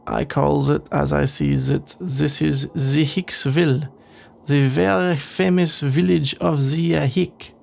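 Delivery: slow, hammed-up French accent; terrible French accent. Stranded traveller naming Hicksville to companion, terrible French accent. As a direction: do a bad French accent, drawn out. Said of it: "doing a terrible French accent".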